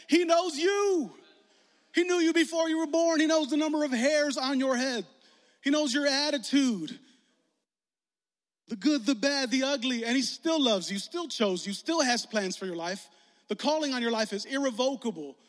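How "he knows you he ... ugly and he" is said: fervent preaching. Acted. Pastor assuring first-time visitors God knows them, fervent preaching. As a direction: proclaim it over the room with full force.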